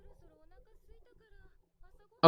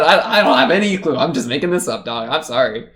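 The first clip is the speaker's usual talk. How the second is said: laughing mock confession; 4 dB louder than usual. Reactor mocking the translator who has no clue, laughing mock confession.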